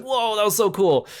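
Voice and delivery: high swooping whoa, then settling; crowd's amazement. Retold or imitated. Audience after his elegant fall, imitated amazement. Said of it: "everyone's like".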